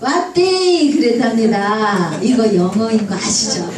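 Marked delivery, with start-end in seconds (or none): 0.0-0.9 high, glad cry in Gyeongsang dialect; high-pitched Gyeongsang dialect call; Gyeongsang dialect exclamation. Acted. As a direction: exclaim it like a delighted Gyeongsang grandmother. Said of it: "경상도 할머니가 너무 반가워서".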